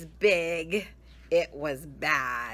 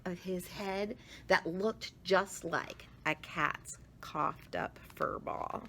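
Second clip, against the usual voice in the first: hushed ending; 5 dB quieter than usual. A narrator's reading. Narration fading to hush.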